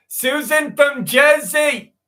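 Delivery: high, slow New Jersey lady voice; Jersey accent. Pretend. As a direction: drag out Susan from Jersey, hammed-up. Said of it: "I can do a Jersey".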